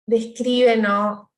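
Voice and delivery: normal talking voice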